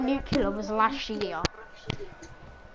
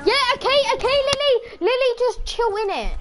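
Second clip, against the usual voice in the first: much higher, excited call to Lily; 11 semitones higher than usual. Real, not acted. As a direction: call Lily to calm down, pitched up. Calling out to Lily.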